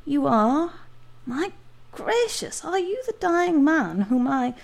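high, breathless disbelief; astonished. Acted. Doctor recognising his patient, astonished. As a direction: gasp it out in amazement.

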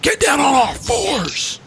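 high, slow, shouted imitation of a bully. Retelling the suit ordering people down, high slow mock shout. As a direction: bark it out like the bigot.

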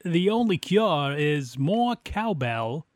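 slow, drawn-out bad movie-quote impression; badly imitated catchphrase, drawn out slowly; horrible impression. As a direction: ham up a bad impression, stretching the punchline. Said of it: "That was a horrible impression".

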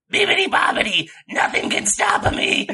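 high, airy villain voice; menacing character voice. Acted. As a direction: deliver the one-liner with menace. Grandpa Zog one-liner voice.

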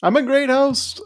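high teasing repeat; mocking echo. Host mocking his co-host's brag, high teasing echo. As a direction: parrot his boast in a high voice.

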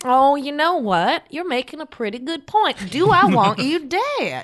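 high, teasing reconsideration; mock musing. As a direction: tease the threat playfully. [name] reconsidering, taunting.

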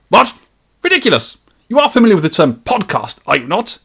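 high, affronted Victorian gentleman; pompous disbelief in a Victorian gentleman's accent. Acted. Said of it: "best read in a Victorian gentleman's accent".

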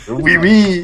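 mock French. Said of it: "Now he's French?".

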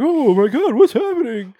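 high, loud shocked squeal; mock shock. Art being hustled out of the bathroom, mock shock. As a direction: play it wide-eyed and panicked, hammed up. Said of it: "shocked face".

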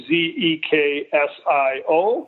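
Z E K S I O said letter by letter; spelled out. Shown as a demonstration. Guest explains the name's sound, spelling out Z E K S I O. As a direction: spell Z E K S I O one letter at a time. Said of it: "pronounce it like it was".

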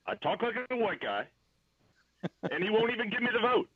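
very badly faked, high-pitched presidential voice; Obama impersonation. Guest grumbles about the vote, bad high Obama voice. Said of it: "that was the worst Obama, uh, impersonation".

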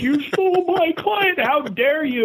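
high, slow, hammed-up indignant agent; mock outrage. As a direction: squeal out a fake threat like a jilted agent. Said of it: "threatening their life".

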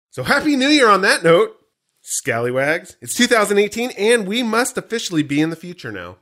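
loud, high, upbeat podcast announcer; show host read. Acted. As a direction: proclaim it to the listeners. Back to scripted show opening.

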